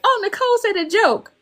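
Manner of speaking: remembering a joke with sudden delight; delighted recall. Retold or imitated. Alone in the elevator recalling a joke, delighted. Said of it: "I was just like".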